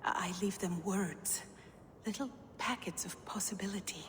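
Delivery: eerie breathy whisper; a secretive, otherworldly whisper. Acted. Gunnlodr whispering to Havi about old magic, eerie.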